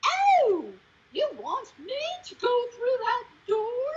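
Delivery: loud high "oh", then stilted line; mock hammy audition in a very high voice; terrible actor. Bad actor redoing his audition for the director, hopelessly stilted. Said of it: "Okay, now do it right".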